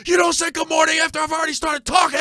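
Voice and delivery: high, loud mock yell; angry stepdad shout. Stepdad scolds Gary for the good morning timing, mock shout. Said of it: "He was like".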